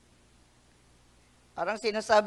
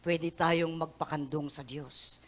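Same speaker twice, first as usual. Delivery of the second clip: usual speaking voice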